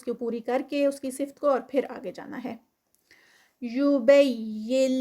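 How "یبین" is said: slow chanted tajweed recitation, long vowels; Quran recitation. Shown as a demonstration. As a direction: recite it slowly with elongated vowels. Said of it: "نا پہ ٹو counts آگے".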